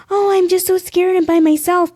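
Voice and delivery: high, put-on little innocent voice; big sweet helpless. Coaching wheelchair users to get help, fake sweet helplessness. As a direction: play up the scared baby deer. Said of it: "your big, sweet, like".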